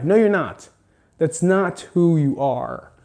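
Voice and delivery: ordinary delivery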